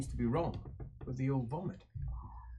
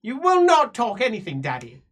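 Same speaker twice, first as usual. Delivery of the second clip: put-on louder, bossy child; 8 semitones higher, 8 dB louder than usual. Daughter overruling her father the Emperor, bossy. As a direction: cut him off like a spoiled princess.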